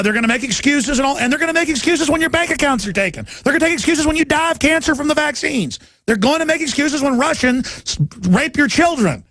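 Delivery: high-pitched, insistent warning; impassioned rant. Real, not acted. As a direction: ride each repeated warning high, pressing it home. Host's escalating rant of warnings.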